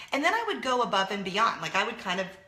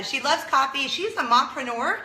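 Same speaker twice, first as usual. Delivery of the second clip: gushing; 5 semitones higher, 6 dB louder than usual. Real, not acted. Gushing intro of friend.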